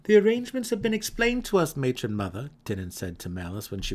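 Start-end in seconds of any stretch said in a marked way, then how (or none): 0.0-2.5 put-on much higher, louder, quick young voice; eager son. A narrator's reading. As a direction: rush the report to his mother. Son Denon voiced in story.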